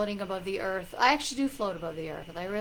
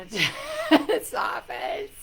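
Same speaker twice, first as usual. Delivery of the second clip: words breaking into high laughter; laughing; 6 semitones higher, 4 dB louder than usual. Real, not acted. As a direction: crack up mid-sentence. Breaks up laughing while talking.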